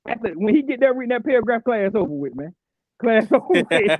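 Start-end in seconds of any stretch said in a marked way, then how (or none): 3.0-3.9 laughing. Real, not acted. Laughing at own story.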